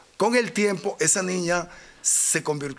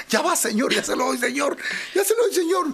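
scared pleading; 9 semitones higher than usual. Retold or imitated. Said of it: "estaba tan asustado".

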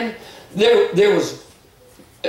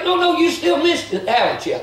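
full-force preaching; 6 semitones higher than usual. Acted. Preacher crying out to the church about God's way, full-force preaching. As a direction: hammer home God's way to the flock.

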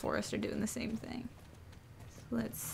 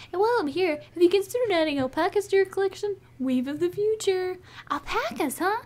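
lively, raised game character voices; eager farm folk; 6 semitones higher, 4 dB louder than usual. Acted. Helpers pitching ideas for the farm, eager character voice.

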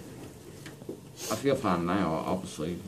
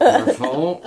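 playful shout; 13 semitones higher, 9 dB louder than usual. Real, not acted. Patient teasing nurse loudly.

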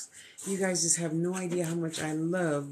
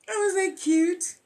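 delighted squeal; 7 semitones higher, 4 dB louder than usual. Real, not acted. Delighted at a card.

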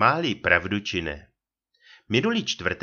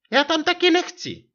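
very high, louder irritated wife; popuzená manželka; 6 dB louder than usual. A narrator's reading. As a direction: huff it in a put-on high wife's voice. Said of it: "pravila popuzeně". (popuzeně, vysokým hlasem manželky)

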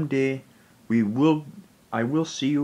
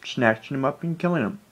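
usual speaking voice

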